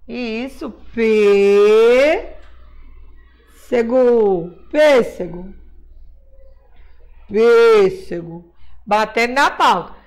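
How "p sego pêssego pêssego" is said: long loud PÊ, then SEGO, then pêssego whole; syllables, closed E. Shown as a demonstration. Teacher spelling for pupils by syllable, long closed PÊ, then pêssego. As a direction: sound out "P... sego, pêssego" with a closed Ê, lower and drawn out. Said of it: "som fechado".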